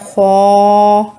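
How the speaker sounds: खौ drawn out slowly; drawn-out letter. Shown as a demonstration. Teacher demonstrates the lengthened letter खौ. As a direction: stretch खौ two seconds. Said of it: "इसमें भी दो हरूफ हैं".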